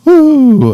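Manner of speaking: high, loud "woo"; excited whoop. Real, not acted. Player whooping during the game, high excited woo. Excited reaction while playing.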